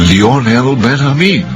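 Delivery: ordinary talk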